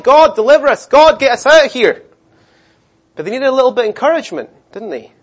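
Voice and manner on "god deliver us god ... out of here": higher, louder pleading cry; crying out to God. Retold or imitated. Israelites cry out to God for deliverance, pleading. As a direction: beg God to get them out. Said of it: "they cried".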